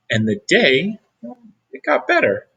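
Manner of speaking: ordinary delivery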